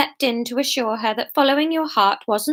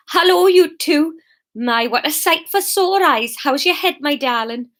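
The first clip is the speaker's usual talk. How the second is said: hearty, higher-pitched Geordie greeting; Newcastle accent; 4 semitones higher than usual. Acted. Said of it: "how to do a Newcastle accent".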